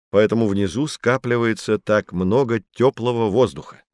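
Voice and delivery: ordinary delivery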